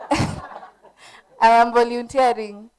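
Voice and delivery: normal talking voice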